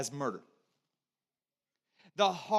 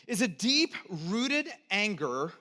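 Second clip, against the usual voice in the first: ordinary talk, nothing marked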